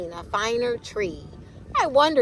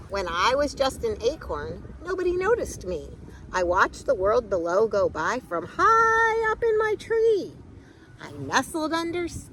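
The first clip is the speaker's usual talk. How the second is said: storybook reading; 4 semitones higher than usual. A narrator's reading. Reading picture book aloud.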